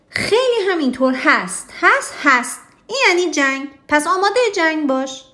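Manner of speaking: high, loud storybook king shouting back; King Sam yelling. Acted. King Sam shouts a declaration of war at King Oscar, hammed-up yell. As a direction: bellow the challenge like a cross cartoon king. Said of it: "پادشاه سام داد زد".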